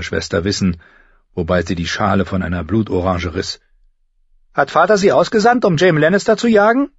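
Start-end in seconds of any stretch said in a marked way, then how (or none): 4.5-6.9 put-on high, loud girlish question; eager younger sister. Younger sister asks if father sent them hunting, eagerly. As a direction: pitch it up as an eager little sister.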